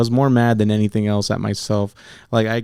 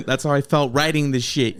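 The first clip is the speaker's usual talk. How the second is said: normal talking voice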